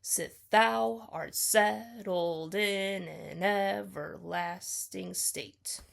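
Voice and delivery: slow, measured read marking each beat; scansion reading. Shown as a demonstration. Reading line seven to show its six feet, slowly. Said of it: "there's six feet in that line".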